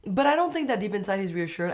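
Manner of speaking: ordinary talk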